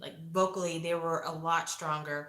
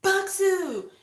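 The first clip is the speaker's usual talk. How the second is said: high, loud imitated demands; hammed-up coach pushing for a shout; loud, high mock coach voice; mock coach yelling; 8 semitones higher, 8 dB louder than usual. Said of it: "And he's like".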